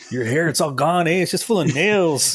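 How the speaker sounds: mock Southern accent. Riffing along in Southern accent.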